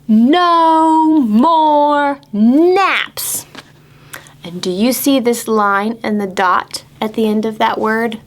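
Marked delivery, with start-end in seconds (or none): 0.1-3.5 slow, stretched-out title; very slow and a bit louder; drawn-out title. Shown as a demonstration. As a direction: draw out each word of the title. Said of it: "This book is called".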